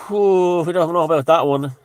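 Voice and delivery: loud, high scoffing disbelief; sceptical whew. Real, not acted. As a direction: blow it off as nonsense, loud. Scoffing at a claim.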